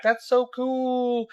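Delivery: put-on high, drawn-out kid voice; wowed kids. Kids to the toy peddler, high awed imitation.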